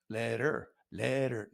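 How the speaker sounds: "letter" with the middle T softened to a D; flapped T. Shown as a demonstration. Comedian showing how Americans say it, D-like T. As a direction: say "letter" with a quick D sound in place of the T. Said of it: "We say it as a D".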